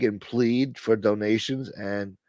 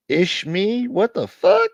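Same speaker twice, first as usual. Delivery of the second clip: surprised; 10 semitones higher, slower than usual. Real, not acted. Surprised to see old viewer.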